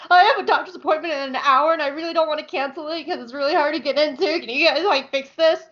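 a lightly voiced urgent customer; pleading quote. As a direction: beg the mechanics to hurry. Pleading with tire shop staff.

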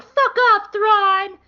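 high, loud retold shout; hammed-up defiant holler; mock angry yell. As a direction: yell it at a cartoon villain. Ezra yells at the enemy.

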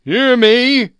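very high, loud command; wailing ghostly shout; ghost's cry. A narrator's reading. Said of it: "cried the ghost".

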